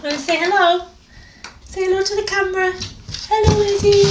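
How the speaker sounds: high-pitched cooing to a pet; squeaky dog voice. Real, not acted. Owner coaxing her dog to greet the camera, squeaky dog voice. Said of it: "my squeaky dog voice".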